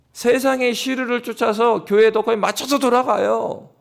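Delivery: ordinary delivery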